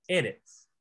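Eretz with the first E stressed; E-retz, weight up front; first-syllable stress. Shown as a demonstration. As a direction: hit the start of Eretz and fall away. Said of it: "la primera sílaba".

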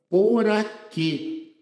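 teacher pointing out the stressed syllable; stressed final syllable. Shown as a demonstration. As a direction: lift and slow down Por aqui, hitting qui. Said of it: "Por aqui é oxítona terminada em E".

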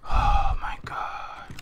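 slow whispered gasp of dread; frightened whisper. Real, not acted. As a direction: breathe it out slowly, barely voiced. Scared while playing horror game.

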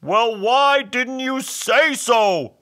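high, loud, slow creature voice; drawn-out hammed-up exasperation; exasperated hobgoblin. A narrator's reading. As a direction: stretch it out like a wailing goblin. Hobgoblin answers Bob's plea.

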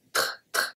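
tr, tr whispered as a bare consonant cluster; isolated ending. Shown as a demonstration. Teacher isolating the ending of votre: whispered tr. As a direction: breathe out just the tr of votre. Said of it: "The last one that I pronounce is the R".

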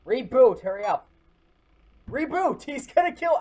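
panicked. Real, not acted. Panic while rebooting.

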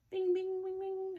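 high, ringing bell imitation; bike bell sound. Teacher showing kids how a bike bell rings, high bell imitation. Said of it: "so they can".

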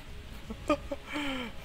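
very high frantic giggle; breathless nervous laughter; panicked laugh. Real, not acted.

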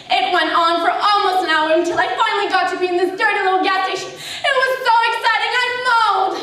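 breathless rush climbing to a high pitch; mounting excitement. Acted. Retelling finally getting to pee on a car trip, mounting excitement. Acting out urgent relief and excitement.

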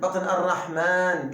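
ordinary talk

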